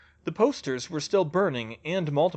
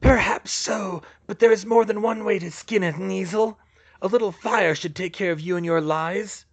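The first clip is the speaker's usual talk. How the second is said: higher, raised dark lord threat; menacing villain, pitched up; scowling villain; 6 semitones higher, 5 dB louder than usual. Acted. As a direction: threaten them as a theatrical villain.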